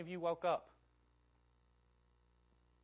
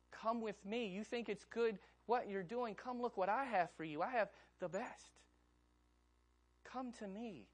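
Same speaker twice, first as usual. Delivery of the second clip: tender pleading invitation; 5 semitones higher than usual. Acted. God pleading, voiced by preacher.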